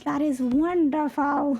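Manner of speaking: high, slow, put-on voice; mock delight. Decorator admiring the little welcome rug, mock delight. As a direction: savor it like a treasure.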